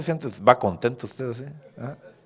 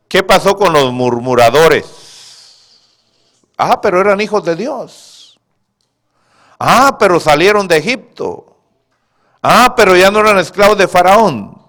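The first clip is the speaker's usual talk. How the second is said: much louder, higher, driving each "pero"; fiery preaching; 9 semitones higher, 12 dB louder than usual. Acted. Preacher hammering the congregation about the grumblers, shouting. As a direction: preach it at full force.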